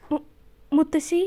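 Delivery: a stammered name, lightly tearful; granddaughter, crying, calls her grandmother with a faltering voice. A narrator's reading. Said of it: "കരയുന്നത് കൊണ്ട് തന്നെ അവളുടെ ശബ്ദം പതറിപ്പോയി".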